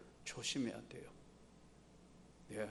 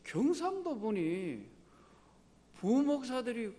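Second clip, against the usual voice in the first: quoted remark rising higher and louder toward the end; retold remark; 9 semitones higher, 4 dB louder than usual. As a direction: build up to a surprised, raised exclamation. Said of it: "좀 말씨 들어보니까". (놀라며 점점 높고 크게 말하는 인용)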